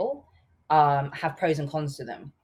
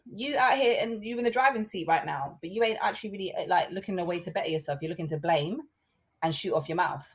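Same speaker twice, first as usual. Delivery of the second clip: ordinary talk, nothing marked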